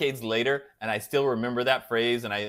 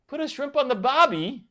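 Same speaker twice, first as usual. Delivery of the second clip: high, less loud second try at Aussie; badly faked Australian accent, pitched up; Jim Carrey's Australian accent; 11 semitones higher, 4 dB louder than usual. Streamer retrying a movie line for Eric, shaky-attempt Australian accent. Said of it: "trying to say it like Jim Carrey from Dumb and Dumber".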